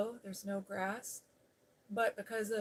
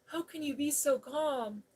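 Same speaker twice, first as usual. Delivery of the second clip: higher-pitched incredulous question; agitated disbelief; 6 semitones higher, 3 dB louder than usual. Retold or imitated. Ex-husband to calm driver after the crash, agitated disbelief. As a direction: ask it raised in pitch, still rattled.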